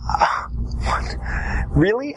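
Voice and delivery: breathy gasp, then a high, rising Really; shocked incredulous. Shown as a demonstration. Debate coach showing shock at the opponent's answer. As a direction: act stunned, almost speechless. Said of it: "shocked, incredulous look".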